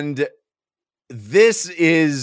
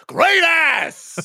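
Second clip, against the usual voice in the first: high, loud Pacino yell; Heat Pacino shout; 4 dB louder than usual. Acted. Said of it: "Ah, Heat Pacino".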